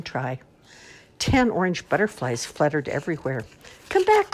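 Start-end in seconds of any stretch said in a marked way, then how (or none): 3.9-4.3 much higher, louder bunny call; bunny crying out. Acted. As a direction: call after them desperately as a little bunny. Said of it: "cried Bunny".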